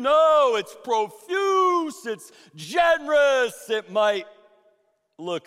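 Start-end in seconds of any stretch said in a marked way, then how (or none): 0.0-3.7 louder and higher, stretching profuse out; preaching crescendo. Real, not acted. Pastor exhorting the congregation, rising to a shout. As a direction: swell up as if grace is overflowing.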